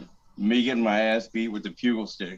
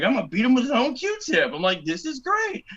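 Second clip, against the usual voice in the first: cocky; 12 semitones higher than usual. Retold or imitated. Cocky recruit before the fight.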